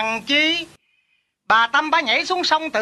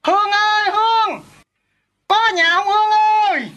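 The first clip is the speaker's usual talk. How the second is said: calling out; 6 semitones higher than usual. Acted. Neighbour calls Hương from outside, calling out. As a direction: call out to see who's home.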